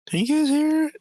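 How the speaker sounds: high and loud, startled; sudden high alarmed question; surprised. Real, not acted. Player asks others, voice jumps up.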